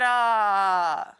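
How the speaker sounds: usual speaking voice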